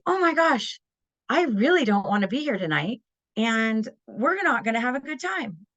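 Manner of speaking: high, bubbly delivery of bad news; cheerful sing-out with tone way up; upbeat tone. Shown as a demonstration. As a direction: gush it as if thrilled, against the words. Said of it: "my face and my tone are up".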